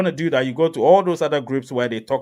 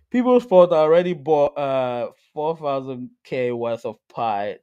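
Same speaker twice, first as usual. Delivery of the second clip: ordinary talk, nothing marked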